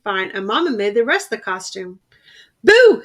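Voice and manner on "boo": a sudden high, loud BOO to scare; shouted boo. A narrator's reading. Little bear jumping out at his mother, loud shout. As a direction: jump out and yell it. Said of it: "he shouted".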